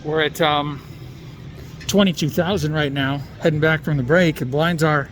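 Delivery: muffled behind a mask; talking through a face mask. Real, not acted. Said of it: "I'm talking through this mask".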